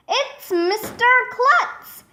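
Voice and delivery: high-pitched excited call; shouted. A narrator's reading. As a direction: announce it with pitch leaping up. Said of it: "Neil shouted".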